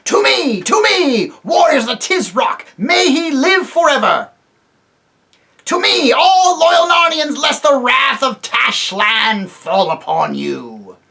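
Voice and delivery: loud rallying cry, high and forceful; calling out to his men. Acted. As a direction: bellow it across a crowd, slowing on the threat. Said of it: "stood calling out".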